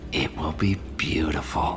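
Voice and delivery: breathy, unvoiced whisper of longing; hushed, rapt whisper; eerie whisper. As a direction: whisper it like a dream come true. Villain musing on his plan.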